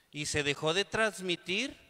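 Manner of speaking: normal talking voice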